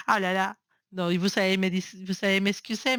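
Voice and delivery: ordinary talk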